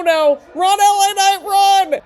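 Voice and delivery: high, loud shout to flee; urgent call. Real, not acted. Fan urging L A Knight to escape with an urgent shout. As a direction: yell at the TV like he can hear you.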